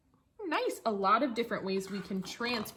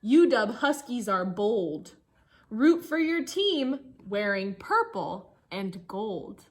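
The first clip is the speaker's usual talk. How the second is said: lively storybook reading for a class; reading to children; 3 semitones higher, 8 dB louder, slower than usual. Acted. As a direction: read the rhyme aloud to the kids, bouncy. Teacher reads picture book aloud.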